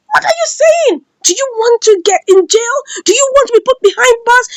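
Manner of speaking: high-pitched outraged questions; indignant challenge. Acted. Man to Angel who wants the police, indignant challenge. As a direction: act out a quarrel, pitch jumping up. Acted quarrel about the police.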